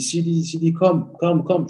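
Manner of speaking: high, urgent call to a player; imitating assistant coach. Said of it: "T'as Ferguson, son adjoint, euh, qui dit". (imitation de l'adjoint qui appelle, voix aiguë)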